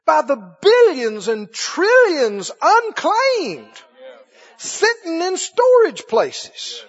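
high, loud, slow preaching cry; fervent preaching. Acted. Preacher crying out to his congregation about money. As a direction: stretch out every word with passion.

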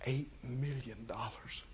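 fearful hushed whisper. Shown as a demonstration. Preacher whispering a feared sum in dread. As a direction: say it like it might hear you.

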